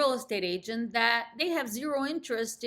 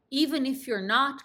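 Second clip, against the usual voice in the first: ordinary delivery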